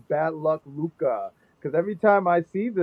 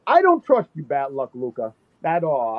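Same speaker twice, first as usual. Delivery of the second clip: mock outrage; 2 semitones lower than usual. Real, not acted. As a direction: shriek your distrust in fun. Addressing Luca directly in jest.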